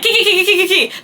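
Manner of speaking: high, laughing kiki kiki giggle; mock giggling. Braiders mocking her in the chair, imitated giggling. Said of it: "They just started laughing".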